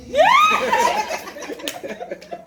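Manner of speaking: real laugh, high and bursting. Real, not acted. Comic laughing at his own story, high laugh. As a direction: laugh at your own punchline.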